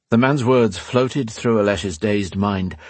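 ordinary delivery, nothing marked

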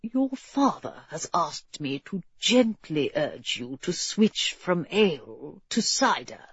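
low, hushed servant's request; discreet whisper. A narrator's reading. Servant passes on a father's request, discreet whisper. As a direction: keep it confidential and low. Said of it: "he whispered in G's ear".

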